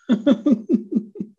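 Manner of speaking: high embarrassed giggle; embarrassed laugh. Real, not acted. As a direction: laugh off the lost thought. Laughing off lost thought.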